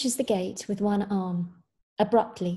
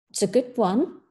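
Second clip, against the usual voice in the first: pointedly approving; 3 dB louder than usual. A narrator's reading. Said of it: "pointedly approving".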